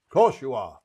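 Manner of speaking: loud, firm "Of course you are"; emphatic reassurance. Real, not acted. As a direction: insist she is good enough.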